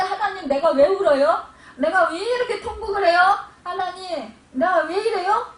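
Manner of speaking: loud, high-pitched cry to God; retold lament, belted out high; imitated wailing prayer. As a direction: act out a bewildered believer wailing out questions. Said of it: "자기도 모르게 막 통곡을 하는 거예요".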